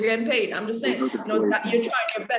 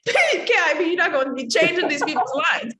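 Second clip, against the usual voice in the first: high, animated, laughing through the words; laughing reprimand; 5 semitones higher than usual. Real, not acted. Coach telling student not to change the writers' lines, laughing. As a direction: scold jokingly while cracking up.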